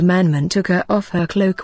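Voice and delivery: ordinary delivery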